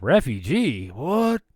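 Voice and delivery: disbelieving echo, jumping high on "What?"; loud, retold double take; astonished. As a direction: echo it back in mock shock. Retold shock at the news.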